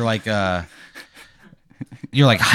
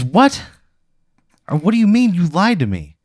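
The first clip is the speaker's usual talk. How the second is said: mock outraged disbelief; 8 semitones higher than usual. Bay shocked at the lie.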